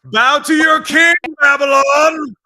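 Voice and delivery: booming king demanding worship; roaring tyrant king. Pretend. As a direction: roar it like a conquering ruler. Hyped self-announcement as sports king.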